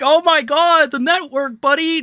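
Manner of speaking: high, loud, mincing parody of a corporate creator; mock corporate whine. Mocking sellout commentators, a high loud whine. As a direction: squeal it like a scared sellout creator. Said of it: "the fucking milquetoast corporate bullshit where I'm like".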